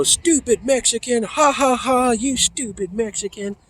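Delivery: high, sneering teen taunt with mocking laughter. Teen taunting a Mexican kid, high mocking jeer. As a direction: jeer at him like a dumb teenager. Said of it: "I jumped in and I go".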